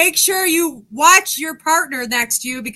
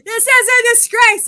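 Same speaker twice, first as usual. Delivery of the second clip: mock chef scolding; 8 semitones higher, slower than usual. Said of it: "I'm gonna yell at myself".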